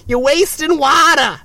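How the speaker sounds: high, drawn-out mock yell; scolding nag. Nag yelling at someone in the shower, mock scolding. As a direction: holler it slowly like someone policing the shower. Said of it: "If people tell me I'm wasting water".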